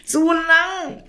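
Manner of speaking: high, grumpy raven croak; sulky raven croak. Acted. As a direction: croak it out, fed up. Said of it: "krächzte Jakob verdrossen".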